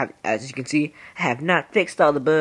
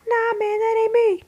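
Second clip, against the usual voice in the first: much higher, squeaky protest. Retold or imitated. Teen gamer protesting to adolescence in a squeaky voice. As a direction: squeak it out like a cartoon kid. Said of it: "I'm like".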